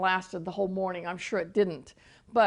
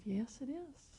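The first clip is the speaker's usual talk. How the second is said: quiet, calm answer from the Lord; a soft, lowered voice for God's answer; the Lord's reply; 4 semitones higher, 15 dB quieter than usual. Retold or imitated. The Lord answering again about the hills, quiet.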